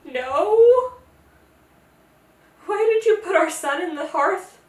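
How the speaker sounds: high, loud bewildered question; baffled. Retold or imitated. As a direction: squeak it out in disbelief. King and queen baffled.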